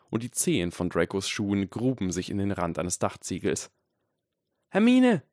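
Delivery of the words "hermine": high, loud desperate cry; high-pitched screaming call; screeched. A narrator's reading. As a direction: shriek the name from a distance. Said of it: "Harrys Stimme kreischte entfernt".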